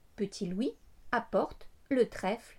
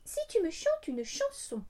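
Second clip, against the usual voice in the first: blackbird voice; 7 semitones higher, 6 dB quieter than usual. A narrator's reading. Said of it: "répond le merle".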